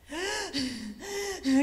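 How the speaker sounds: worn-out panting, acted; imitated gasping. Speaker re-enacting stopping on the run, imitated gasps. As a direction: gasp for air as if mid-run. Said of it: "Guess who stops? I do".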